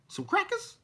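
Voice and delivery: very high-pitched mocking squeak; mock fearful. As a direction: squeal it as a scared, put-on question. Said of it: "talk like he was fearful".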